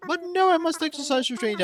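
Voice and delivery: high, fussy put-on curator voice; delighted museum curator. Curator examining a visitor's fossils, high play-acted delight. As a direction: play an admiring expert, mostly pitched well up. Museum host assessing the socks.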